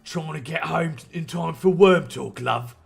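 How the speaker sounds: put-on light British accent on Dietrich's line. Old team member answering Monica, in a light British accent. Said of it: "wrote him to have a, a British accent".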